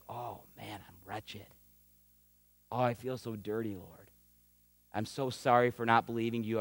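very soft, low, hushed confession; barely voiced, pour out remorse; broken repentance. Hushed low confession as a humbled believer repents to the Lord. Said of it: "you feel like".

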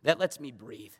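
ordinary talk, nothing marked

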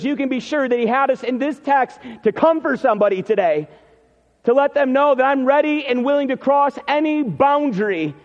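impassioned pulpit declaration; preaching. Acted. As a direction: preach it out to the congregation. Preaching climax.

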